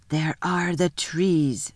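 slow, low, lightly voiced beaver; a lightly played talking beaver, slow and wary; lisping buck-toothed beaver.

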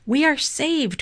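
high, relieved storybook cry; narrated cry with pitch well up. A narrator's reading. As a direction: exclaim at being rescued.

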